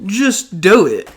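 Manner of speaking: loud, high, drawn-out "do" as "dough". Acted. Mocking forty-year-olds' slogan, "do" pronounced dough. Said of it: "they would pronounce do as dough".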